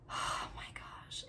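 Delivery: breathless hushed oh my gosh; awed whisper. Real, not acted. Whispering to viewers about a moving clip. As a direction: whisper it in awe.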